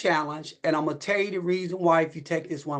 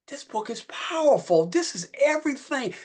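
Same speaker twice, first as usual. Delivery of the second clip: excited retold reaction; 8 semitones higher than usual. Retelling his reaction to the book.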